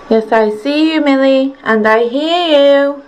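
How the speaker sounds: talking to the dog. Real, not acted.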